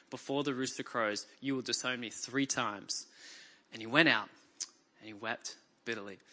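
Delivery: low, soft scripture reading; subdued, sorrowful narration fading away; hushed remorse. A narrator's reading. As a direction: let the voice sink almost to nothing. Peter's remorse, scripture read in hush.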